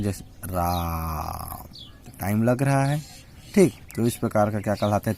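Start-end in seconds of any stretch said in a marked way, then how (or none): 0.0-1.7 रा held very long and slow, fading softer; prolonged vowel. Shown as a demonstration. Teacher showing a pluta vowel by holding रा, drawn out. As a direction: stretch the आ in रा to triple length. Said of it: "इस प्रकार का ये मात्रा युक्त रहता है".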